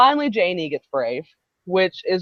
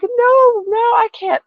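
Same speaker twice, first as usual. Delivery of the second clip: very high, mock-innocent protest; protesting denial; 5 dB louder, slower than usual. Fan answering the singer's teasing, mock protest.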